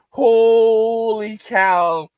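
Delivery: long drawn-out holy cow, loud; slow amazed holy cow; astonished exclaim. Real, not acted. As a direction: stretch it out in disbelief. Exclaiming at a comment.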